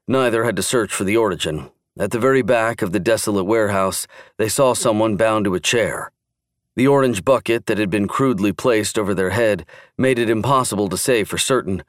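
ordinary delivery

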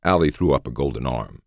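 ordinary delivery, nothing marked

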